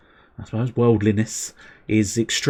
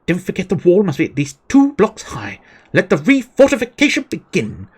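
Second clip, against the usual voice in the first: raised pitch, steady narrated orders; lightly voiced commander; 9 semitones higher, 4 dB louder than usual. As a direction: read the general's orders aloud. Reading quest giver's dialogue.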